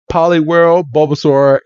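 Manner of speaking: ordinary talk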